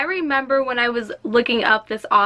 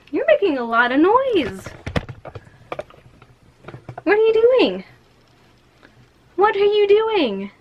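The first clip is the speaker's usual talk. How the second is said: much higher, sing-song voice for a pet; pet talk; 8 semitones higher than usual. Real, not acted. Booktuber asking her noisy pet what it is doing, high coo.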